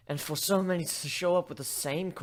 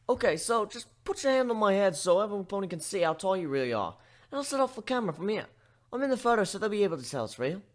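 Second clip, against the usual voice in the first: lightly higher curious pony; a hint of an eager young pony, questioning; eager filly prodding for proof; 8 semitones higher, faster than usual. Pony sets up photo.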